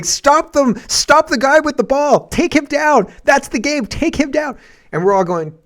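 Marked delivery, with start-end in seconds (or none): 0.0-4.6 high, loud, urgent mock yell; imitated screaming. Streamer acts out the one guy screaming at onlookers, imitated screaming. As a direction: yell it like the lone guy screaming at his team. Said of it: "one guy who's screaming".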